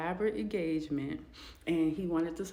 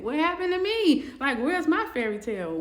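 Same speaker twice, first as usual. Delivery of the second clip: startled dismay; 8 semitones higher, 7 dB louder than usual. Retold or imitated. Man wakes to his lonely life.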